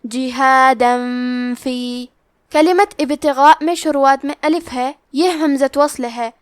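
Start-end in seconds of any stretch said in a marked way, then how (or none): 0.0-2.1 جہادن فی slowly, the tanween n hidden in a held ghunna before fa; ikhfa with ghunna. Shown as a demonstration. Tajweed teacher demonstrating ikhfa, slow recitation. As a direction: hum the n of جہادن into فی. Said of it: "یہ حکم کو غنہ کے ساتھ ادا کرنا ہے".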